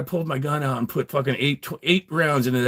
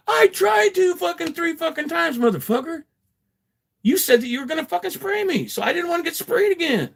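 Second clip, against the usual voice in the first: high, strained yelling back; angry yell back. Retold or imitated. Arrested man yelling back at the cop, high and strained. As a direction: shout back, outraged and high. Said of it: "I'm like".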